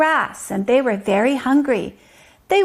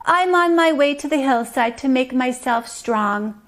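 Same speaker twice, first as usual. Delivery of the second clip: ordinary delivery, nothing marked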